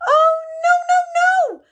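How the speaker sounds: very high, loud panicked cries; terrified squeak. A narrator's reading. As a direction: squeal no in terror. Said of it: "squeaked Little Mouse".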